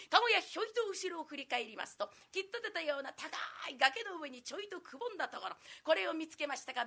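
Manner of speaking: ordinary delivery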